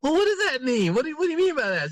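high-pitched, retold bewildered questioning; baffled disbelief. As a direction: squeak out a mock-incredulous demand. Retold baffled reply to sister.

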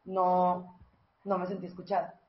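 calm, tender complaint to husband; soft calm tone. Shown as a demonstration. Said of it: "Hablas un poquito así como".

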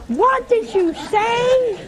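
high, slow, baffled "What did you say?"; baffled northerner. Retold or imitated. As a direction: stretch it out like you can't understand a word.

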